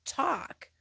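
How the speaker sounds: impatient, rising to a snap on talk; exasperated. Retold or imitated. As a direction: hand the mic over, fed up. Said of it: "Okay, well, talk".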